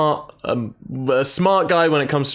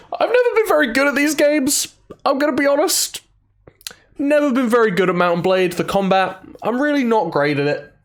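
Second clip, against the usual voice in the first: squeaky confession; 11 semitones higher than usual. Real, not acted. Self-deprecating confession to stream.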